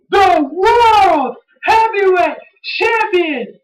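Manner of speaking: high, drawn-out champion introduction; hyped-up announcement. Pretend. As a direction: belt it out slowly in a high, straining voice.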